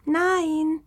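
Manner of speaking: high, tiny creature voice, drawn out; small high-pitched fox voice; fine little voice. A narrator's reading. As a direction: answer in a dainty little squeak. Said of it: "antwortete ein feines Stimmchen".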